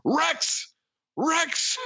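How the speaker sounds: high yelled name; screaming. Retold or imitated. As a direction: call out the name in a shrill panic. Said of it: "just screams".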